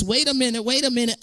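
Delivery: ordinary delivery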